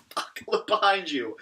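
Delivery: high, loud, stretched-out warning; hammed-up cry of alarm, drawn out slowly; mock panicked warning. Jericho warns of the demon.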